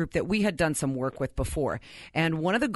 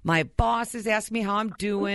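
ordinary talk, nothing marked

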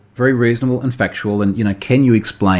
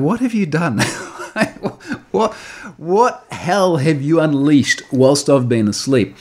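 laughing, higher-pitched incredulous question; mock disbelief; 4 semitones higher, slower than usual. Messaging a friend about sixty comments, amused disbelief. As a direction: ask it aghast but amused, drawing it out.

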